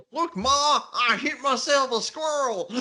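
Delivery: put-on high, whooping hillbilly accent. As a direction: play it as a proud hillbilly kid calling Ma. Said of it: "I decided to do the hillbilly accent there".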